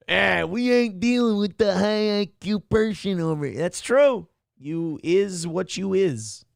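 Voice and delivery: lightly voiced parody of an "ain't" talker; mocking imitation. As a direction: mildly mimic the heckler's folksy grammar. Mimics the clip's "ain't" speaker.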